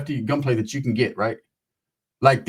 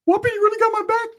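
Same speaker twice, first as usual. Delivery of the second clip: much higher thrilled squeak; squeaky Ricky voice; faster than usual. Retold or imitated. Ricky thrilled his cousin has his back, squeaky. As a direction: squeak with childish delight.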